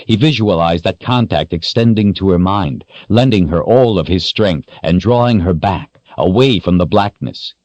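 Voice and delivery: ordinary delivery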